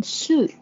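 soup with a long, rounded oo; long oo sound. Shown as a demonstration. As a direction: stretch the oo in soup slowly. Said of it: "O U就发一个ood音".

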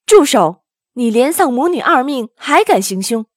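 loud, commanding rebuke; stern shout. A narrator's reading. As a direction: bark an order to halt, then scold. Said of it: "被唐僧喝住". (厉声喝止)

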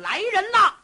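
loud battle-cry call to troops; alarm shout. Acted. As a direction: shout out, rallying the men. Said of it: "大喊了一声".

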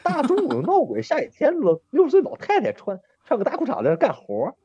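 mock spooked. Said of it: "我就害怕嘛".

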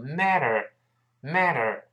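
American flapped t in "matter"; American pronunciation. Shown as a demonstration. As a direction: say "matter" as "madder", American style. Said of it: "中间这个T读快之后会轻轻浊化一下".

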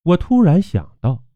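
plain reading voice

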